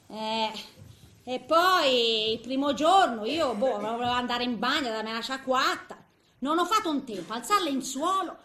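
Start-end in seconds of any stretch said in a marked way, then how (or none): none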